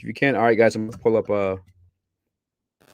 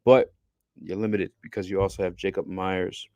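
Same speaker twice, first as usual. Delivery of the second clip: ordinary talk, nothing marked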